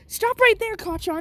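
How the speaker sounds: high, sharp order to stop; teacher's shout. Acted. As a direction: yell it across the classroom. Teacher yells to stop fight.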